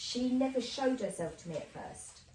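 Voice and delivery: ordinary delivery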